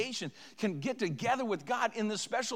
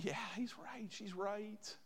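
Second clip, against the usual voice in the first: quiet agreement; 4 semitones higher, 14 dB quieter, slower than usual. Retold or imitated. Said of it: "others would see and hear and say".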